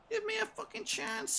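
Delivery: high exasperated cry; pleading yell. Real, not acted. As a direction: beg the game to be fair. Raging at the game.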